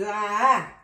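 loud open "ah"; short, loud exclamation; sudden outcry. Real, not acted. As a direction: burst out with a loud cry. Cries out while channeling energy.

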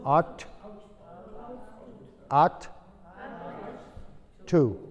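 "Ought" said as "aut", no g or h sound; a teacher showing that the GH in "Ought" is silent. Shown as a demonstration. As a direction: say "Ought" as a plain "aut", then "to" apart. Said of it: "La G H no se pronuncia".

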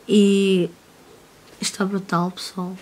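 normal talking voice

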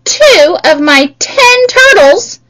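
ordinary talk

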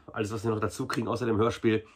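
ordinary talk, nothing marked

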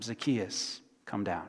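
soft, low, tender call by name; hushed tender summons; gentle call. Acted. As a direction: call him down quietly, kindly. Jesus calls gently by name.

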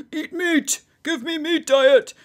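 high, hammed-up caveman hooting; mock caveman. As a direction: play a silly caveman demanding food. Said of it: "isn't that like the caveman".

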